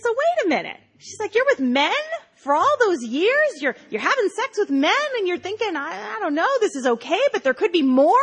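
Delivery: high, pushing, incredulous questions; high mock Rosie. Rosie grills mom about years with men, high and incredulous. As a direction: press on high and disbelieving.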